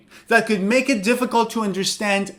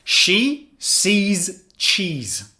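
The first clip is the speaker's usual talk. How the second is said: She sees cheese said very slowly; teacher reading a tongue twister slowly; slow demo; much slower than usual. Shown as a demonstration. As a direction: spell out each word of She sees cheese. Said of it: "beginner level tongue twister".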